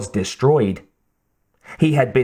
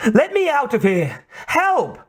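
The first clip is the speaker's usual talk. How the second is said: high-pitched desperate cries; shouting for help; 12 semitones higher than usual. A narrator's reading. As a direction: shout high, begging to be freed. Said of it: "Kate shouted".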